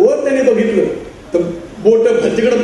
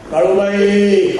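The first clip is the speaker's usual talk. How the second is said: drawn-out, respectful call to the goddess; reverent slow address; 3 semitones lower, much slower than usual. Retold or imitated. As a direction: call the goddess slowly and with devotion. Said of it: "आमची आई तिच्याशी बोलते". (देवीला हळू, भक्तिभावाने हाक)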